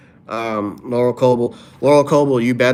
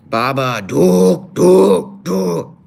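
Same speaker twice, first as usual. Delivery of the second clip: character voice; 6 semitones higher, 7 dB louder, much slower than usual. Retold or imitated. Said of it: "Let me see if I can do an impression".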